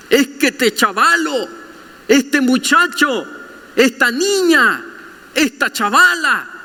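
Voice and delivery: high, exasperated parent; a hint of a fed-up Nicaraguan parent; complaining parent. Parent complaining about their own kid, mildly exasperated.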